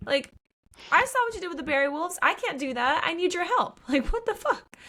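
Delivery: rising into a high, frantic plea; high squeaky freakout at being abandoned; panicked Odessa. Retold or imitated. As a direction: voice climbing in disbelief and panic.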